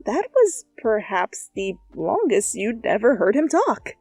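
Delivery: ordinary delivery, nothing marked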